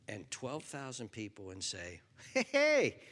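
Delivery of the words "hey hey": high, loud, cheerful call out; excited call. Pretend. Preacher acting faithful disciple, excited call. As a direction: hail the crowd with delight.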